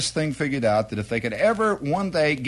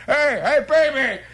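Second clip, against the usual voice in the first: mock fan greeting; 10 semitones higher, 4 dB louder than usual.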